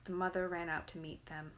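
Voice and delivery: ordinary delivery, nothing marked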